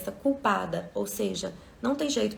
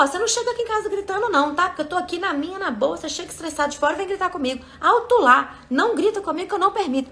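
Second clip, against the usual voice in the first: much higher, louder, fast angry yelling; shouting back; 8 semitones higher, 7 dB louder, faster than usual. Retold or imitated. Speaker acting out yelling back at a stressed partner, furious shout. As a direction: lose your patience and yell back hard. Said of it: "Tô gritando de volta".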